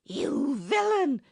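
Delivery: high, loud, slow cry of rage; raging at the villain, fist shaking; furious scream. A narrator's reading. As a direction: scream the insult out, drawn and high. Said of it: "she screamed".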